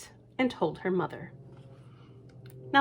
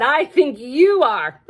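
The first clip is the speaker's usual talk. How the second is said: louder, laughing at own joke; teasing brother laughing; 7 semitones higher, 10 dB louder, slower than usual. Acted. Brother mocking Lucy and laughing. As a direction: tease her then crack up.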